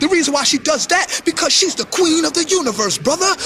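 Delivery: high-pitched, preachy apologist; mock earnest pleading. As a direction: plead her case, hammed-up. Mimics a man excusing her behaviour.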